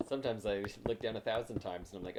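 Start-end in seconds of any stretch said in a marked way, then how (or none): none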